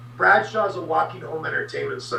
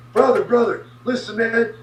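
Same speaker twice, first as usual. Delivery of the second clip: higher, pleading brother-brother; pleading Bradshaw; 7 semitones higher than usual. Retold or imitated. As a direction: plead to calm him down, pitched up. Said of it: "Bradshaw's like".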